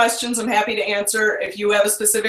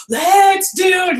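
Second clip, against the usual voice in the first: excited; 8 semitones higher, 4 dB louder, much slower than usual. Retold or imitated. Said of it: "I'm always telling Dave".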